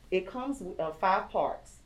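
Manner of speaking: ordinary talk, nothing marked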